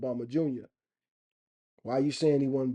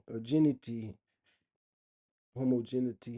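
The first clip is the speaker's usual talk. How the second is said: usual speaking voice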